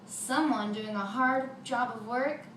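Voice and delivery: usual speaking voice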